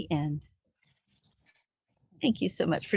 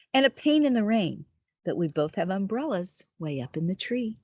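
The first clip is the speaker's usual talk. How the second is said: lilting, sing-song read-aloud; reading to children; 3 semitones higher, 4 dB louder than usual. Acted. Storyteller reading a rhyming book to little listeners, sing-song. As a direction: read it like a bedtime rhyme.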